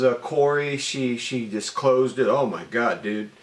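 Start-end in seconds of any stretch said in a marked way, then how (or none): none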